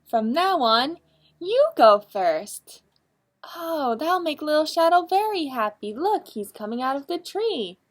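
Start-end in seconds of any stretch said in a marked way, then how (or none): 0.0-2.8 louder, slow kind promise; generous offer. Acted. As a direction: give the turn away gladly. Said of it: "He says".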